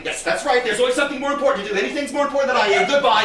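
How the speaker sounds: wounded huff; huffy martyrdom. Acted. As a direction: lay on the grievance, then ring off. Offended mother ends the call.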